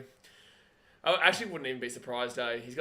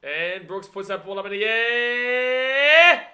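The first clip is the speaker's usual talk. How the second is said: last word held long, climbing high and loud; excited kick call; 8 semitones higher, 13 dB louder, much slower than usual. Real, not acted. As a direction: let the final word soar with the ball. Calling a high kick live.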